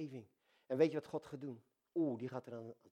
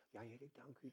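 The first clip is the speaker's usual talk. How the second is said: much softer, hushed little prayer; 18 dB quieter than usual. Shown as a demonstration. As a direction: murmur the thanks gently. (zachtjes gebeden)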